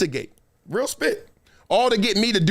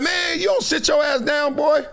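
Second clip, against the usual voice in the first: mock scolding; 7 semitones higher than usual. Scolding someone before money.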